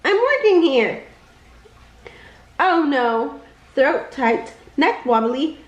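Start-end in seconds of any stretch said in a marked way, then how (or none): 0.0-1.0 tiny shout. Acted. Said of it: "a tiny voice shouted".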